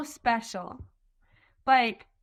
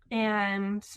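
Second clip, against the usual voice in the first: usual speaking voice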